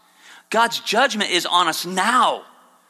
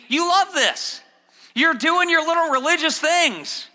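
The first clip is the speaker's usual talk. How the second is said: sarcastic mockery; 9 semitones higher than usual. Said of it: "very much a sarcastic thing".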